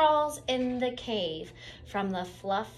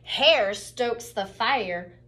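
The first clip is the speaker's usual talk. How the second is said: usual speaking voice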